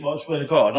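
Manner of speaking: ordinary talk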